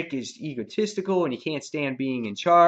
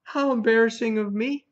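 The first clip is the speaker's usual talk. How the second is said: high-pitched sarcastic apology; hammed-up false sheepishness; mock embarrassment; 9 semitones higher, 4 dB louder than usual. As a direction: act out a taunting fake oops. Acting Negan mocking the gutted Spencer.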